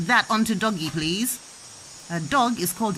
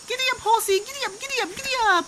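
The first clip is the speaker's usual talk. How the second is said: playful giddy up; 13 semitones higher, faster than usual. Pretend. Urging toy horse on.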